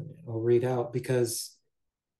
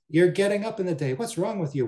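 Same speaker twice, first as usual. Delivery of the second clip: higher, louder, quicker scolding of a lazy maid; scolding mistress; 7 semitones higher, 4 dB louder than usual. Acted. Mistress scolds her maid for rising late, in a raised, quick scolding voice.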